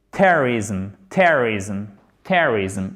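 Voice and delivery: "terrorism" low-pitched, TER stressed and middle swallowed; first-syllable stress. Shown as a demonstration. Teacher drilling learners on the word, stress on first syllable. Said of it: "l'accent tonique sur la première syllabe".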